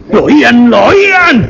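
high, strained cry of terror; horrified outburst. A narrator's reading. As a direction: blurt it out in sheer horror. Narrator's sudden horrified realisation.

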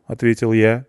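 usual speaking voice

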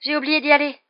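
high, loud realisation; dismayed exclamation. A narrator's reading. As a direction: cry out aghast at the slip. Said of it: "s'exclama-t-elle".

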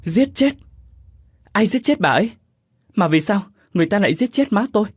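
high, loud horrified cry, rushing at the end; shocked cry. A narrator's reading. Son learning his mother was murdered, high horrified cry. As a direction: cry out in disbelief at the news. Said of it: "rú lên".

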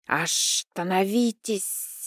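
slow hissing snake woman; drawn-out hiss of a serpent. Acted. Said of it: "прошипела вторая змея". (медленное шипение змеи)

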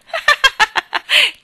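high, loud cackling laugh; burst of laughter. A narrator's reading. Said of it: "scoppiò in una risata". (scoppio di risa acuto)

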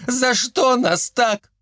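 high, drawn-out lament; plaintive wail. A narrator's reading. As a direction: whine it slowly and high. Captured men wail in protest. (жалобно, протяжно)

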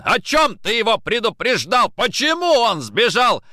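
loud, high interrogation; angry shout. A narrator's reading. Nikitin demanding why the uncle fled, angry shout. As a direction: fire accusing questions at full voice. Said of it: "закричал Никитин".

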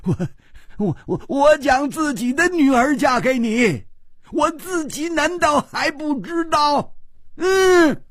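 high, stammering furious outcry; angry roar. Acted. The king insists he knows his own daughter, roaring high. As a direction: sputter with rage. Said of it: "龟兹王又怒吼道".

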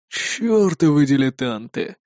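low, slow weary exasperation; resigned sigh. A narrator's reading. Raider gives up on the useless bikers, resigned low sigh. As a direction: sigh it out, soft and drawn out. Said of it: "обречённо вздохнул налётчик".

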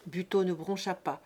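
ordinary delivery, nothing marked

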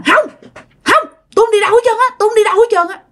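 put-on high, rapid girly protest; squeaky girlish voice. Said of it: "nói cái giọng eo éo như là con gái".

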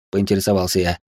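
ordinary delivery

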